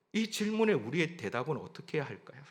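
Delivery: usual speaking voice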